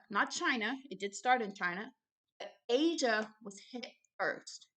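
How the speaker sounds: normal talking voice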